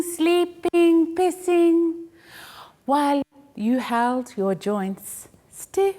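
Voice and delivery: ordinary talk, nothing marked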